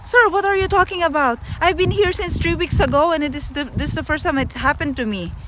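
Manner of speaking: high, fast, frantic plea to the agent; Tagalog-English high frantic plea; high pleading exasperation. Retold or imitated. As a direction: beg him, voice up high and rushed. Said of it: "I said".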